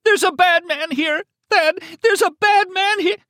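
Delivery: piercingly high, loud scream; terrified screaming, clearly enunciated. Acted. Bleeding captive warns man on phone, screaming. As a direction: scream each word out clearly in panic. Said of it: "she was enunciating clearly again".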